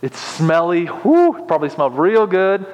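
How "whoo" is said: high-pitched "whoo"; acted reaction to a strong smell; high exclaimed whoo.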